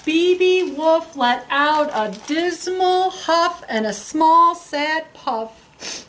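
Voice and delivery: slow, drawn-out, sad telling; slow sad huff. A narrator's reading. Narrator sighing out the wolf's sad puff. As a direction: drag the words out sadly.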